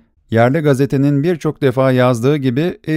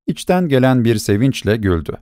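ordinary delivery

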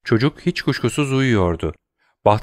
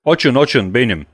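shout; 3 semitones higher, 5 dB louder than usual. A narrator's reading. Wilford shouting at the locked door, loud and urgent. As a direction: demand they open it, loud. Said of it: "diye haykırdı Wilford".

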